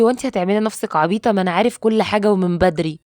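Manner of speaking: usual speaking voice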